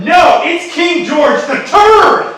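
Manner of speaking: loud, high, drawn-out cry; theatrical shout of recognition; outraged announcement. Acted. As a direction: proclaim it loudly, stretching the name. Louder correction on recognising the king.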